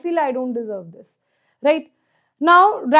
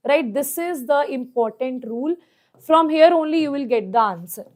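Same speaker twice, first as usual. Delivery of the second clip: normal talking voice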